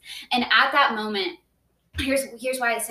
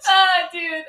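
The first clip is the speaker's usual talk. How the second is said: high, loud laughter with the words; cracking up mid-word; laughing hard; 8 semitones higher, 8 dB louder than usual. Real, not acted. Reacting to her friend's joke, laughing hard.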